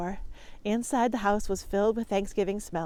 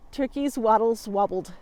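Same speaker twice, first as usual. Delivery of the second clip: ordinary delivery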